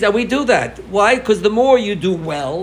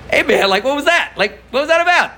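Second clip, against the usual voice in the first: put-on higher, louder, kid demanding an answer; a ten-year-old's outraged protest, mildly acted; indignant kid; 11 semitones higher, 4 dB louder than usual. Kid protests a sudden slap.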